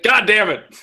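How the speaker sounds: high, loud burst of frustration; exasperated outburst. Real, not acted. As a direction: swear at your own slip, exasperated. Reader slips again on reread.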